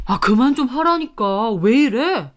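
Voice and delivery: lightly voiced annoyed husband; irritated husband. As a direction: tell a wife to back off. Husband resists, pushes wife away. (짜증 내는 남편 목소리)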